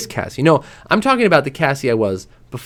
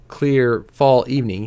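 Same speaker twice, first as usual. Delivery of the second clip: ordinary delivery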